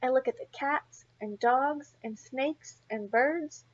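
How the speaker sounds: plain reading voice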